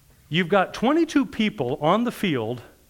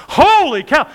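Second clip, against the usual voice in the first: loud, high "Holy cow"; stunned outcry; amazed exclamation; 11 semitones higher, 10 dB louder, slower than usual. Retold or imitated. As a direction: blurt out astonishment. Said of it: "I'm going".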